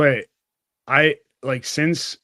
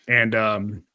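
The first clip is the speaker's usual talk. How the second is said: ordinary delivery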